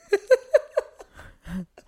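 whispered, helpless giggle; breathless giggle. Real, not acted. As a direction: laugh silently into the question. Laughing mid-question.

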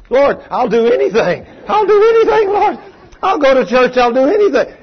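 loud, high, begging cry to the Lord; desperate pleading cry. Retold or imitated. Woman begging God for healing, loud pleading cry. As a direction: wail it out, promising anything.

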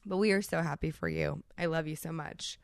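usual speaking voice

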